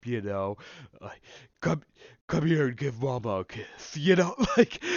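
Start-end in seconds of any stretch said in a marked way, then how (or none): 1.6-4.0 a hint of a campy drag queen. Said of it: "drag queen sorta stereotype". 4.0-4.8 laughing at her own bit. Real, not acted.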